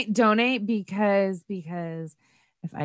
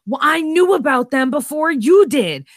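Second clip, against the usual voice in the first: mock shout; 5 semitones higher, 7 dB louder than usual. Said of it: "you can be like".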